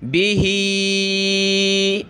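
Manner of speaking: बिही with the ही stretched long; long standing kasra. Shown as a demonstration. Teacher stretching the long kasra for students. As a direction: draw out ही in बिही very slowly. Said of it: "नीचे की तरफ खींचेंगे".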